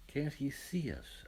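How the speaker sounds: slow, a touch anxious; worried question, drawn out; anxious angels. Acted. Angels worrying Adam can't see them, mildly anxious. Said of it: "asked the angels anxiously".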